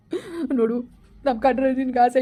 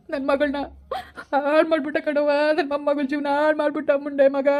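high, sobbing lament; anguished cry for a wronged child; grieving wail; 4 semitones higher than usual. Acted. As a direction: wail the accusation through tears. Laments the granddaughter's ruin.

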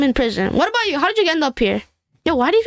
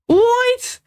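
shocked what; 8 semitones higher than usual. Real, not acted. Shocked at the food served.

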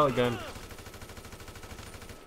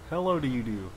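lower, softer repeat of hello; low greeting; 4 semitones lower, 6 dB quieter than usual. Pretend. Gamer repeating a playful greeting, low and soft. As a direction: drop the greeting low and quiet.

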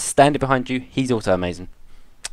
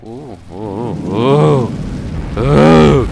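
rising scared oohs, louder each time; spooked ooh; 3 dB louder, much slower than usual. Real, not acted. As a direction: ooh nervously, building up. Spooked by game.

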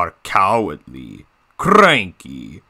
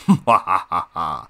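villainous cackle; Diesel 10; 3 semitones lower than usual. Acted. Villain diesel showing off his claw, evil laugh. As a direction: laugh like a gloating cartoon villain. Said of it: "doing my own Diesel ten voice impression".

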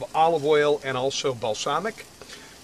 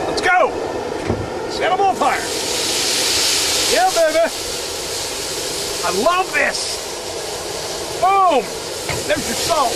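excited shouting; 12 semitones higher than usual. Real, not acted. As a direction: hype it up like a fireworks show. Setting mussels on fire outdoors.

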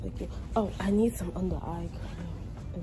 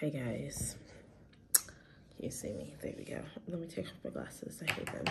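groggy just-woke-up voice; barely awake morning voice; 6 semitones lower, 10 dB quieter than usual. Real, not acted. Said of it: "My morning voice".

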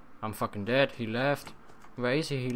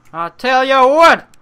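much higher and louder, hammed up; loud declaration; 11 semitones higher, 17 dB louder than usual. Shown as a demonstration. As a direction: proclaim it to the crowd. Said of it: "repeated louder".

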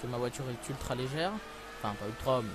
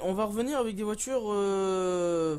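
loud announcing; 4 semitones higher, 6 dB louder, slower than usual. Real, not acted. Announcing next race loudly.

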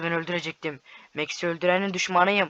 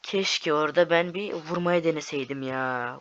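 ordinary talk, nothing marked

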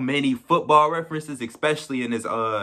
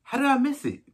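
disbelief; 6 semitones higher, 3 dB quieter than usual. Retold or imitated. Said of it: "I'm like".

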